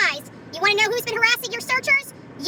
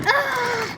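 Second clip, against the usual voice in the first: angry groan. Real, not acted. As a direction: let out a burst of real anger. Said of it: "I am so angry".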